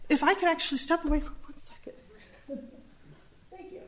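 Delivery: strained, breathy near-whisper after coughing; choked up. Real, not acted. Presenter excusing herself from a coughing fit, choked up. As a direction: squeeze the words out quickly while choking. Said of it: "I'm choking on air".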